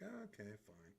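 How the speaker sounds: softly voiced mild boredom; a soft, grudging give-in; almost bored. Artist voicing the bored character he draws, soft and mildly bored. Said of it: "almost bored".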